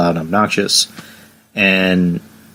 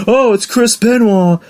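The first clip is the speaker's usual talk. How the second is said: put-on much higher, slow, overly chummy drunk voice; drunk fan greeting; 13 semitones higher, slower than usual. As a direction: play the drunk suddenly starstruck and friendly. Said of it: "He looks over at Chris and he goes".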